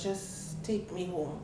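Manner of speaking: soft, slow, defeated plea; slow tired murmur, nothing left; weary plea. Retold or imitated. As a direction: let the words sink, spent and ashamed. Exhausted plea after embarrassment.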